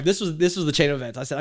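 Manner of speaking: ordinary talk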